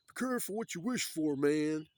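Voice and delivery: loud, high acted caution; big exaggerated cautionary shout; mock warning. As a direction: call it out as a hammed-up warning. Acting out a saying as warning.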